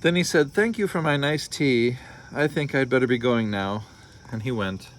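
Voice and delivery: storybook read-aloud with voiced characters; story narration. A narrator's reading. Parent reading a picture book to listeners, lively storytelling. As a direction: read it like bedtime story time.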